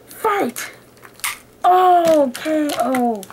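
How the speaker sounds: high call of fight like an arcade announcer; booming fighting game announcer. Pretend. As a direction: announce the round, then KO. Announcing toy fight round.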